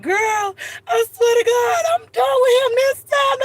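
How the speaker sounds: high, slow, wailing fake sob to a friend; hammed-up crying girlfriend; a high crying wail, broken up and overdone; mock crying wail. Friend on phone crying about man.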